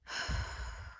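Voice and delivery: long audible exhale out; deep sigh. Shown as a demonstration. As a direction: breathe out slowly and heavily. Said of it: "and I breathed".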